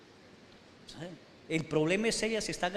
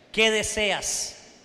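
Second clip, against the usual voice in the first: rational wife; 4 semitones higher, 6 dB louder, much slower than usual. Retold or imitated.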